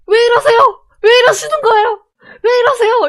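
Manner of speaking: loud, high re-enacted yell; yelled protest. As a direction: shout at them to back off. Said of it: "소리 질렀던 게 아직도".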